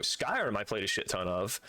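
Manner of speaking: ordinary delivery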